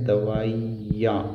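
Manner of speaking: दवाइयाँ said slowly and clearly; slow correct word. Shown as a demonstration. Teacher slowly shows students the correct दवाइयाँ. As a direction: spell out दवाइयाँ slowly, each syllable clear. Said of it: "जबकि सही ये है".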